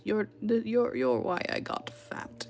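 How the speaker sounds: imitating brother-in-law. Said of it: "I can't do his accent".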